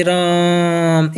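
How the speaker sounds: राम stretched very slowly with the आ held long; drawn-out raam. Shown as a demonstration. Teacher showing children a pluta vowel: very slow, stretched राम. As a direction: pull the आ of राम out like a call. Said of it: "प्लुत स्वर के example".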